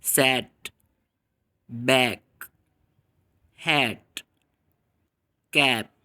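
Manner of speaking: बैक, हैट, कैप with the open a of cat; teacher demo of the cat vowel; short a words. Shown as a demonstration. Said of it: "For example".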